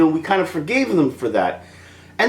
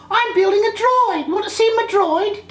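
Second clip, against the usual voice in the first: put-on much higher eager child voice; little boy Anakin. Vlogger mocks young Anakin, high eager kid voice. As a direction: play an excited little kid showing off.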